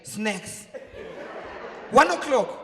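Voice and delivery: ordinary talk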